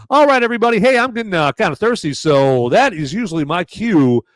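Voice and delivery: high, loud, pumped-up show host call; loud and bright kickoff of his beer segment; excited segment intro. Real, not acted. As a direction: fire up the audience for the segment.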